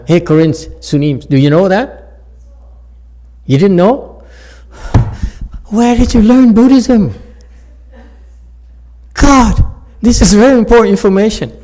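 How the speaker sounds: calling out loud and high to the monks; teasing shout. Real, not acted. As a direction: bellow at them in mock outrage, fast at the end. Teasing the Korean monks loudly.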